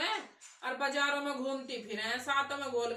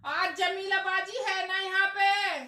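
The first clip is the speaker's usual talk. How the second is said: higher, louder, slow pretend servant asking; playful fake maid calling, higher and louder; 6 semitones higher, 8 dB louder than usual. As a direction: ask for the lady of the house in a put-on maid voice. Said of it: "मैं मजाक कर रही".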